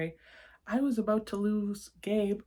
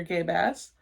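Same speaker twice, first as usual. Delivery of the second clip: ordinary delivery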